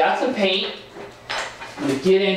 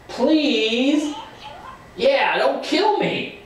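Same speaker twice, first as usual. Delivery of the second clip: mock pleading; 10 semitones higher, much slower than usual. Brother begs not to be killed.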